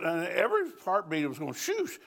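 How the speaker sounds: ordinary talk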